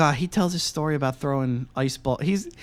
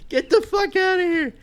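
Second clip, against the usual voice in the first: laughing disbelief; 13 semitones higher than usual. Real, not acted.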